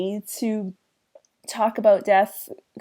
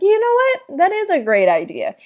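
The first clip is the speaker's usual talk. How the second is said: higher, louder mock enthusiasm; Cliff's eager idea; 12 semitones higher, 5 dB louder than usual. Cliff teasing the kids about a funeral, mock eager. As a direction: pop up with a playful great idea. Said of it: "Cliff is like".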